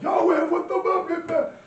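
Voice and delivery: high, loud imitated protest; mock outraged yelp. Mimicking dude alarmed at the scene.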